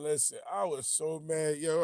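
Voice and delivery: worked up. Real, not acted. Venting about the fight.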